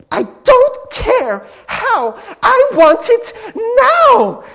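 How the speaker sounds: high, slow, loud bratty yell in bad British; Veruca Salt. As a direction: stretch each word into a spoiled tantrum. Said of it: "girl just yelling".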